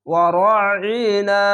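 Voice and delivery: ওয়ারাইনা slowly, each long vowel held; drawn-out recitation. Shown as a demonstration. Teacher modelling the one-alif stretch, slow and drawn out. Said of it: "এক আলিফ টেনে পড়বেন".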